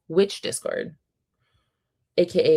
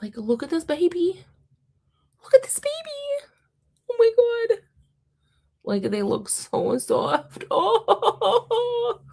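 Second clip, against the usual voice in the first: very high, choked-up squeaky coo; overwhelmed by cuteness, voice way up high; real happy tearing up; slower than usual. Real, not acted. Gushing to viewers over baby kittens, tearful squeal. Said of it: "literally tearing up. I'm gonna cry".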